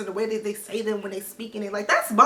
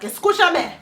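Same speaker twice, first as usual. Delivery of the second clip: emphatic serious command, higher and louder; 7 semitones higher, 6 dB louder than usual. Retold or imitated. Money Heist character demanding to be heard, serious. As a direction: demand their attention, dead serious. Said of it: "in such a serious manner".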